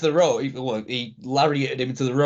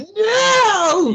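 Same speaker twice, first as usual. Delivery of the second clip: shouted No much higher and louder; crowd shout; 6 dB louder than usual. Retold or imitated. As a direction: yell it like the whole crowd. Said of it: "they're going".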